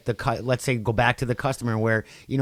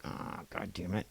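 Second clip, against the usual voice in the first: under-breath curse; 5 semitones lower, 16 dB quieter than usual. Retold or imitated. Catching herself swearing.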